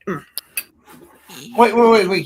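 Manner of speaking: rising, loud, urgent calls to stop; excited interruption. Real, not acted. [name] cutting in on the group, loud and eager. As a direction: cut in eagerly with an idea.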